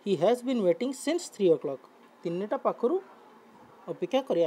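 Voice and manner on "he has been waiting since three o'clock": slow model reading. Shown as a demonstration. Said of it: "teacher rereads example".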